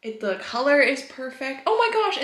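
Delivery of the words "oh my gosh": excited gasp. Real, not acted. Delighted by the hedgehog mug.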